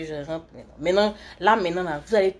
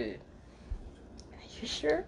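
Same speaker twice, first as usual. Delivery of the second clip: very soft, slow whisper; whispered aside; 4 semitones higher, 15 dB quieter, much slower than usual. Real, not acted. Livestreamer checking with someone off the stream, whispered aside. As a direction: whisper it, drawing out the question.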